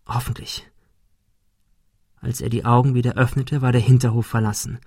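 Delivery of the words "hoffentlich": hushed, unvoiced whisper; whispered thought. A narrator's reading. Boy hiding in the drum hopes unseen, whispering. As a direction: whisper it to yourself.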